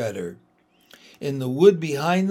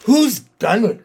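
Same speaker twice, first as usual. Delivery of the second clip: high, loud distressed cry; 6 semitones higher, 9 dB louder than usual. Acted. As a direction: call out in anguish. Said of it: "cried Susan".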